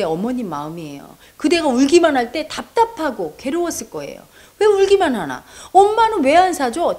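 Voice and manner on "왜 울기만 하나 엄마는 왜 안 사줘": higher, louder indignant protest; a sulky daughter snapping back at mom; daughter talking back. Acted. 엄마에게 대드는 딸, 높고 큰 항의.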